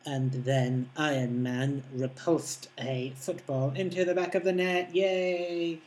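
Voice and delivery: slow, mock-excited nerd; nerdy fan voice. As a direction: ham up a lonely geek's commentary. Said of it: "lonely nerd out there that goes".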